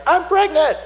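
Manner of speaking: high, loud, squealing joy at the news; giddy announcement. Pretend. Barren woman announcing her pregnancy, high and loud squeal. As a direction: blurt it out like a thrilled old woman. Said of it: "you're not gonna be a woman who goes out and be like".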